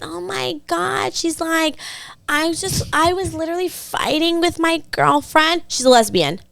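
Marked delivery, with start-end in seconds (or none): 0.0-1.1 slow, high-pitched mimicry of a woman; imitating her talk. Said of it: "she talks like this". 2.3-5.6 high-pitched mimicry of a woman; imitating her talk. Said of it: "she talks like this".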